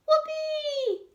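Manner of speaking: high, loud cheer of joy; whoopee. Acted. Pam cheering Bruno's catch, high loud whoop. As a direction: cheer as the cat catches the ball. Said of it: "exclaims Pam".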